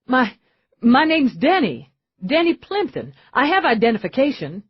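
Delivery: feigned fear. Acted. Darren pretending to be Denny under arrest, faking panic. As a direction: play the frightened innocent. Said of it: "perfect mix of fear and confusion".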